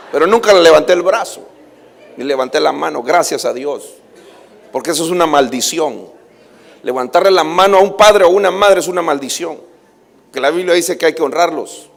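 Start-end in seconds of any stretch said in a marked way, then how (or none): none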